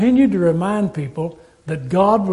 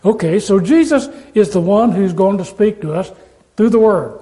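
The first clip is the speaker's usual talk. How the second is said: ordinary talk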